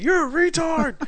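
high mocking imitation; angry caller. Host imitates the caller insulting him, high mock voice. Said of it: "He's like".

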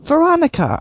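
shrill mock greeting; high-pitched. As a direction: voice jumps up high on the name. Sudden high call of name.

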